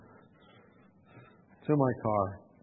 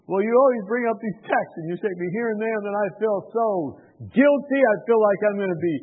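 imitated protest, raised in pitch; mildly distressed complaint; 5 semitones higher, 5 dB louder than usual. Reluctant host tells visitor why he feels doomed, mildly distressed complaint. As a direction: pour out a retold grievance, higher and louder. Acted complaint of frightened man.

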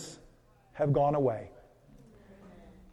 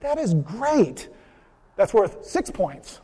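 higher, slow, beaming praise; cheery Saint Peter; 5 semitones higher, slower than usual. Retold or imitated. Saint Peter congratulating the accountant, slow and beaming. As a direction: stretch out the delight like a proud gatekeeper. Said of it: "And Peter goes".

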